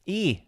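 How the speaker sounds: "E" as a high vowel coming down; high falling A. Shown as a demonstration. Testing how the accent says Adrian, "E" falling. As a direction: let "E" glide downward. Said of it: "Be a high A".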